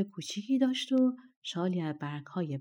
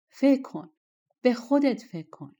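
raised, urgent and louder, higher; urgent call; 5 semitones higher, 6 dB louder than usual. A narrator's reading. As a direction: press him hard, almost calling out. Ghost urging the shepherd. (فریاد اصرارآمیز با صدای بلندتر و زیرتر)

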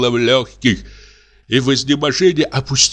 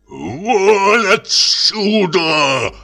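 "Вон отсюда!" pressed out very slow and strangled; stifled, choked voice; 10 semitones higher, much slower than usual. A narrator's reading. Clerk to the old woman, a slow strangled order. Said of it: "тихим, придушенным голосом".

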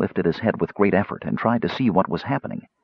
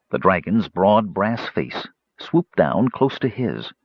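ordinary delivery

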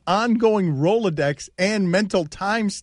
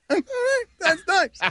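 laughing. Real, not acted. Laughing at own awkward interview.

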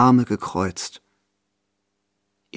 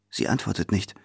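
plain reading voice

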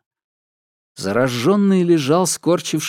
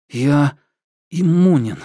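stammering; 2 semitones higher, 4 dB louder, slower than usual. Acted. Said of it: "запинаясь, произнёс он".

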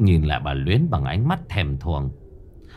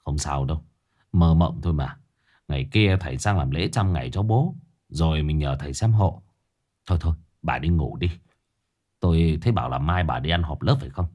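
low, soft, soothing husband; Ông Lưu; 6 semitones lower, 3 dB quieter than usual. A narrator's reading. Husband patting frightened wife's back, calmly reassuring. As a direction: reassure her quietly, keep the pitch down. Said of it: "Ông Lưu vỗ lưng vợ".

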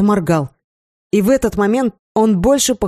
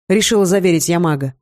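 usual speaking voice

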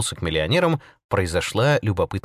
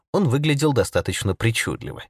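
plain reading voice

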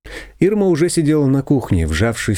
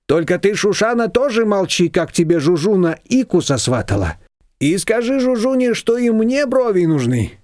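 higher, gossipy shop-owner voice; a chatty confectioner telling tales; confectioner's voice; 9 semitones higher than usual. A narrator's reading. Confectioner gossiping to the women, higher and chatty.

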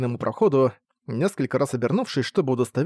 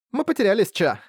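put-on girl voice; 8 semitones higher than usual. Linfa admits they are lost.

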